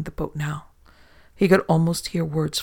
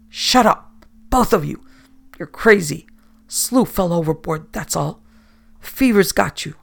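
much louder furious captain; angry yelling; 5 dB louder than usual. Acted. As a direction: shout them down in feverish rage. Captain yells at crew.

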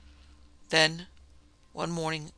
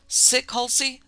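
demanding; 9 semitones higher, 6 dB louder, slower than usual. A narrator's reading. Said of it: "the guard demanded".

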